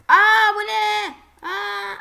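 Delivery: high, loud, drawn-out gasp of surprise; loud startled what is this; surprised. Real, not acted. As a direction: caught off guard by something odd. Sudden obstacle in the game.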